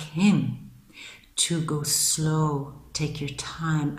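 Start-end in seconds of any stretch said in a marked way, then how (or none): 1.4-4.0 slow, softer, unhurried; gently slowed advice. Real, not acted. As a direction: ease off and take your time saying it. Advising to slow down.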